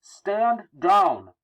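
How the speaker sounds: high, loud, projected order; police command. Acted. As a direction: order them firmly to back off.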